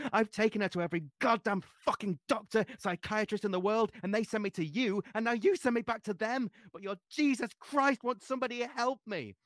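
high, loud shriek of fury and fear; shrieking in anger and fear. A narrator's reading. Mother shrieking at the priest for help, high and loud. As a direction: scream it at him in desperation. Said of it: "The heart-stopping shriek".

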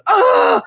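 hammed-up pained yelp; mock yell. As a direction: shriek it cartoon-style. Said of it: "yelling at his legs".

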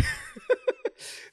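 squealing high laugh. Real, not acted. Host laughing at his lucky guess, squealing.

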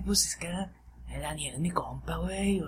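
slow, hushed, breathy mock defense; mock whisper. Imitating the coworker excusing his friend, mock whisper.

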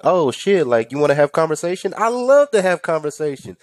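high, mock-delighted exclamation; imitating an over-keen talker; eager chatty customer. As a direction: gush as if thrilled to chat.

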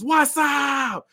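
high cocky taunting shout. Acted. Wrestler taunting his opponent, high cocky shout. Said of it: "opens his legs up and says".

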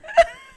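squeaky high-pitched giggle. Real, not acted. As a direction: burst into giggles. Giggling before goodbye.

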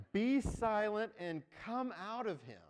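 loud, slow command with a laugh in it; commanding rebuke. Acted. Jesus commanding the spirit to leave, loud and slow. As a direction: order the spirit out, firm and slow. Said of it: "Jesus rebuked him".

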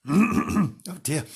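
plain reading voice

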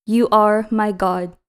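ordinary talk, nothing marked

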